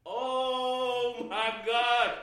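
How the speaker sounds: slow, drawn-out disbelief; stunned oh my God. Retold or imitated. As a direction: stretch it out, awestruck. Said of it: "I was like".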